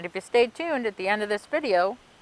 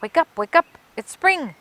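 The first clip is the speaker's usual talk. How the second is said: bright, higher cheer of an eager dog; 9 semitones higher, 7 dB louder than usual. Acted. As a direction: cheer them awake with joy. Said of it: "cheered the dog".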